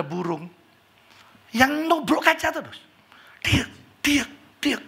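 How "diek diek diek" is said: mimicked tapping. Bird tapping on window.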